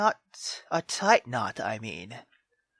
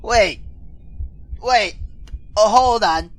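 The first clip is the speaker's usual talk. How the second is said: loud; 5 dB louder than usual. Real, not acted. Reacting to mother interrupting.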